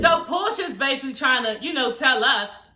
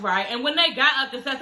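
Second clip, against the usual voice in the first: normal talking voice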